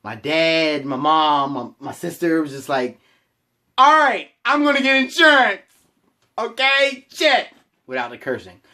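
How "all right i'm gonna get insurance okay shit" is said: high, loud, fed-up mock groan of giving in; exasperated. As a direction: blurt it out loud and high, sick of being nagged. Exasperated reply to nagging family.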